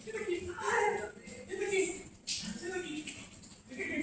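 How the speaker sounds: soft, high-pitched "Senta aqui", hissed under the breath; man at the gym quietly ordering the woman to sit; hushed urgent command. Retold or imitated. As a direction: quietly urge her to sit back down before anyone notices.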